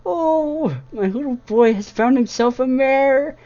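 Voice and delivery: high, loud squeal of delight; happy squeals. A narrator's reading. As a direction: gush like a thrilled mother. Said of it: "a series of happy squeals".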